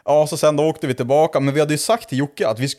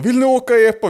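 high, louder imitated kid voice; eager teen kid; 10 semitones higher, 5 dB louder than usual. Car kid invites strangers for a ride, high mock voice. Kids invite the drunk men.

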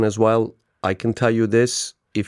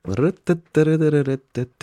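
higher-pitched rat-a-tat tongue noise; rapid nonsense rattle; 4 semitones higher than usual. Shown as a demonstration. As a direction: rattle off syllables to mimic a hectic day. Imitates his rattling busy day.

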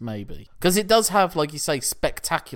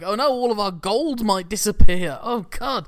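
panicking rich man; 8 semitones higher, 9 dB louder than usual. Retold or imitated. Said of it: "it's like".

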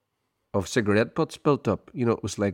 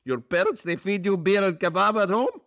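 incredulous Turkish owner; 12 semitones higher than usual. Retold or imitated. Owner scoffs at student paying, high accented disbelief. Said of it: "What do you say?".

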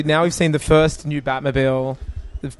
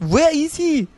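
Russian villain, high and hammy; imitating a Russian thug; bad Russian accent; 13 semitones higher, slower than usual. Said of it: "he says in a very bad Russian accent".

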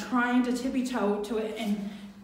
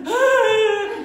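high loud mock scream; panicked yell; 12 semitones higher, 10 dB louder than usual. Imitating her little brother, a loud high panicked ah. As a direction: yelp like a panicked kid. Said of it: "he's like".

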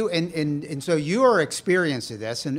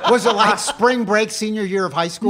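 louder, higher, laughing question; laughing tease; 8 semitones higher, 7 dB louder than usual. Real, not acted. Teasing co-host about her tattoo, laughing.

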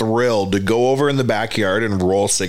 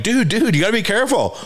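high, imitated dad pleading; acted-out worried warning, pitched up; pleading caution; 8 semitones higher than usual. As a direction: plead with a careless little kid. Retelling pleading with messy child.

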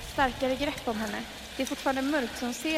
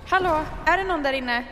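loud, higher calling through a door; 4 semitones higher, 7 dB louder than usual. Acted. Frightened woman calling through a locked door, loud. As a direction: call out to whoever is inside, louder each time.

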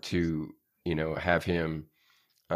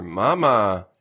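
imitating toddler calling mama; 3 semitones higher, 8 dB louder than usual. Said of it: "his first words are".

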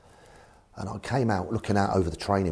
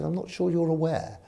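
put-on high-pitched posh accent; Eddie Rogers; 9 semitones higher than usual. Chairman answers his manager politely, mock posh high voice. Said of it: "'cause very posh, Eddie".

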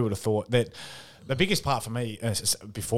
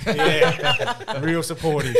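laughing sarcasm; 5 semitones higher, 5 dB louder, much slower than usual. Real, not acted. Sarcastic about old teammates.

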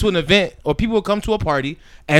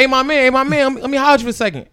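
calling someone over; 6 semitones higher, much faster than usual. Retold or imitated. Imitating man calling him over.

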